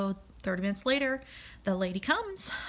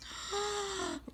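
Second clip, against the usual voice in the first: sudden high intake of breath; sharp gasp; 12 semitones higher, 8 dB quieter than usual. Real, not acted. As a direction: gasp as it clicks. Realising who the woman is.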